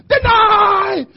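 very high, loud, drawn-out shout; coach's full yell. Retold or imitated. Frantic coach jumping up and down, full-force yell. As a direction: bellow it with veins popping out of your neck. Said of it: "with his veins popping out of his neck".

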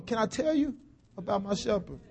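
ordinary delivery

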